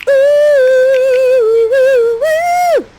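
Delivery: loud, high sung "ooh"; sustained high wooing note; high fanfare "ooh". Acted. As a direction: croon a long high fanfare. Fanfare after introducing Miranda.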